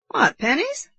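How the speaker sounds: high, amused mocking question; laughing uncle. A narrator's reading. Uncle doubting the boy's stakes, amused. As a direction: tease with a touch of laughter. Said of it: "laughed the uncle".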